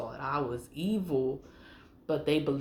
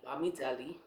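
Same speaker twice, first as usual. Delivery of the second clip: ordinary talk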